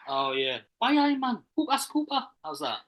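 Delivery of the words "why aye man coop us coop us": high-pitched Geordie impression; Newcastle accent. Attempting the Newcastle voice a co-host asked for, high Geordie. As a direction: put on Geordie, pitched right up.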